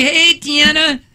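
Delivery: high-pitched mock Jersey greeting; hammed-up Jersey call-out; Jersey accent. Shown as a demonstration. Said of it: "try it. Say".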